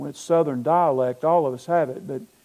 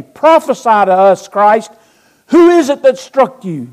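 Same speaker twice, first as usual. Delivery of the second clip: loud, high shouted challenge; forceful acted shout of the crowd; mob taunt; 6 semitones higher, 15 dB louder than usual. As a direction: hurl it at the prisoner as a jeering demand. Acts out mob taunting Christ.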